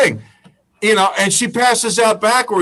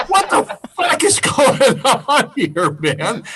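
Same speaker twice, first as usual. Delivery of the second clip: bewildered onlooker; 5 semitones higher, slower than usual. Retold or imitated. Imagined bystander reaction.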